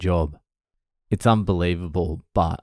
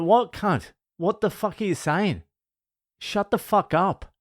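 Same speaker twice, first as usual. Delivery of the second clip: much higher, mock outraged comeback; telling off the boss, high mock outrage; retold high retort; 11 semitones higher than usual. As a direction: fire it back at the boss, pitch up.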